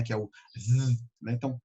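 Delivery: ordinary talk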